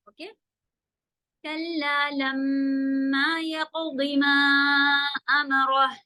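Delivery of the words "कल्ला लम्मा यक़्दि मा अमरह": drawn-out chanted verse; slow Quran recitation. Shown as a demonstration. Teacher reciting the verse in slow chant. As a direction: chant it slowly, holding the vowels. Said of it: "एक हरकत लेंगे और heavy भी करेंगे".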